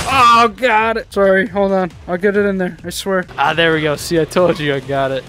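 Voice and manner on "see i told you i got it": ordinary talk, nothing marked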